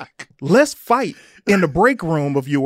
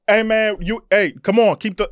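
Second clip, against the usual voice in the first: raised, higher, cutting-in voice; mock impatient agent; retold impatient interruption; 5 semitones higher, 3 dB louder than usual. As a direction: imitate an agent hurrying someone along.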